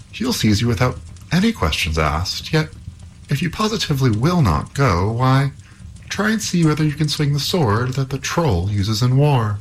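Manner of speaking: high-pitched, insistent storybook princess; urging princess. A narrator's reading. Princess tells Halvor to try the sword, urging.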